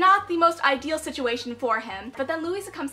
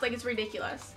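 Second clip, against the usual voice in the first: ordinary delivery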